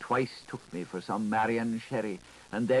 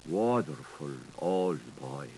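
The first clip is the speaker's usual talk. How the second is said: low slow fond address; 9 semitones lower than usual. Acted. Addressing husband fondly.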